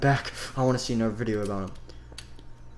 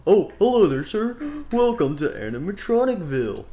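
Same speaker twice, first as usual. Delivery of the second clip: louder, higher cheerful mayor voice; mayor character voice; 7 semitones higher, 5 dB louder than usual. Acted. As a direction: greet the visitor like a showy town mayor. Mayor character greets newcomer.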